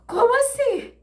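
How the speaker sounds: high shocked exclamation; shocked cry. Retold or imitated. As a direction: burst out in disbelief. Shock at hearing daughter.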